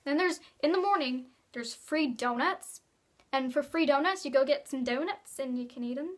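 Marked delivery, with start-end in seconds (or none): none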